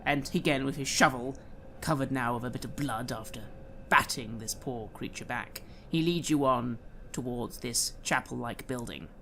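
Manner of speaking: ordinary talk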